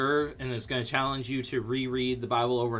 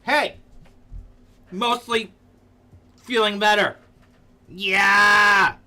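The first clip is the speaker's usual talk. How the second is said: higher, louder celebration; cheering; 4 semitones higher, 6 dB louder, slower than usual. Real, not acted. Streamer cheering a friend's recovery, higher and louder. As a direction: cheer her on.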